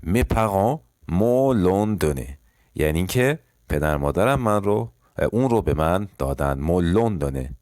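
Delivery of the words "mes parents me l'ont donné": slow repeat. Shown as a demonstration.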